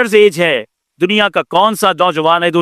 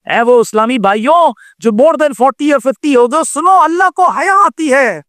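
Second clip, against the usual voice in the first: high-pitched, urgent exhortation; impassioned preaching; 7 semitones higher than usual. Real, not acted. Preacher calls on brothers over forty or fifty, impassioned preaching. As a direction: call out to the congregation in a raised preaching pitch.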